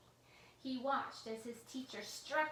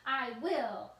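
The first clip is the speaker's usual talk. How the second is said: angry threat; 3 semitones higher, 8 dB louder, slower than usual. A narrator's reading. Villain vowing to crack the computer, loud angry. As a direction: vow it through clenched rage.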